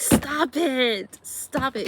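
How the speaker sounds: scared stop it. Retold or imitated. Telling the cousin to stop, loud and spooked. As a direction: beg her to stop, spooked.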